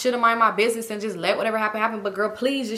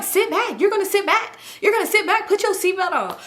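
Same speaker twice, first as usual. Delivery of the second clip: high, forceful mock command; insistent, high-voiced bossing; imitated stern ordering; 10 semitones higher than usual. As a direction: lay down the law, imitating. Imitates friend restraining the other.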